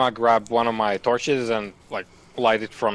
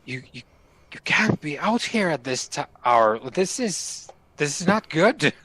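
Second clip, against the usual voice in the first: flustered; 5 semitones higher than usual. Acted.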